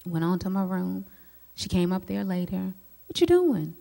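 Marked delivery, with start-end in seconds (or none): 3.1-3.8 mom checking in. Retold or imitated.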